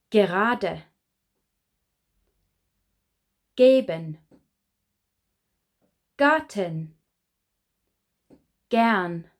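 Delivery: each word opens with a hard G, said clearly and alone; model pronunciation. Shown as a demonstration. Teacher models hard G words for students to repeat. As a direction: hit every initial G hard, a word at a time. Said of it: "you must remember to pronounce it G, not J".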